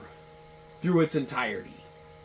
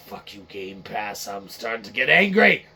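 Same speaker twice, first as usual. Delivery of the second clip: usual speaking voice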